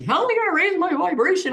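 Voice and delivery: high, fast, anxious seeker voice; mock fretting seeker. Mocking people who fret over their vibration, high and fast. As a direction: parody the worried beginner.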